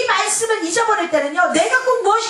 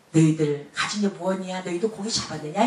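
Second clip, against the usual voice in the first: gentle low question; 10 semitones lower, 6 dB quieter than usual. Acted. Jesus asks, low soft.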